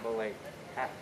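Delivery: soft coaxing. Real, not acted. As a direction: murmur it gently. Coaxing the animal softly.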